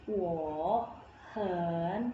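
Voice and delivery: "Wo, hen" each in a full dipping third tone. Shown as a demonstration. Teacher shows learners the unchanged tones, third tone on "Wo, hen". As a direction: say "Wo" and "hen" apart, each dipping then rising. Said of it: "nada ketiga dan nada ketiga".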